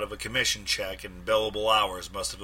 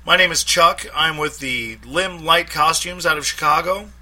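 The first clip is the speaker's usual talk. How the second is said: posing as a salesman; 9 semitones higher, 8 dB louder than usual. A narrator's reading. Detective poses as salesman.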